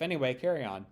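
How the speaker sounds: ordinary delivery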